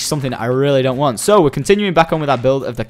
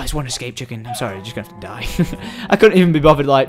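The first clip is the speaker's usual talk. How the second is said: normal talking voice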